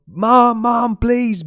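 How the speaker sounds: put-on high, loud pleading cry of a small kid; imitating a child shouting. Child locked outside shouting for his mom. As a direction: yell like a locked-out child begging.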